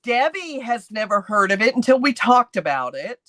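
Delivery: normal talking voice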